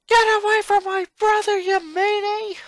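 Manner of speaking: put-on high, loud, fierce little-girl yell; angry girl shout. Sister leaping onto the gator to save her brother, fierce yell. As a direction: shout her down like a scrappy tomboy.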